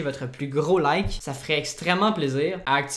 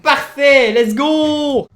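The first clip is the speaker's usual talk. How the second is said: higher and loud triumphant cheer; excited cheer; 10 semitones higher, 11 dB louder, much slower than usual. Real, not acted. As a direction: celebrate the finished effect. Effet terminé avec succès. (cri de victoire aigu et fort)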